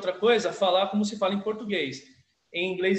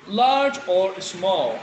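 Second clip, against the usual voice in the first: slow model line; 6 dB louder, much slower than usual. Shown as a demonstration. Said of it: "teacher models the dialogue line".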